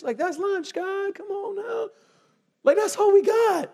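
high, whiny protest to God; mock disciple complaint. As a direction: play the skeptical disciple holding out a lunch.

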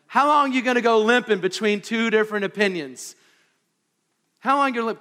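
rising sermon intensity; full-force preaching. Acted. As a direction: drive the question home from the pulpit.

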